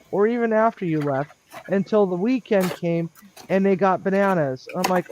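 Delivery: ordinary delivery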